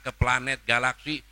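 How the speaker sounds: ordinary delivery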